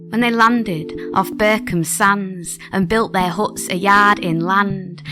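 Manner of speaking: ordinary delivery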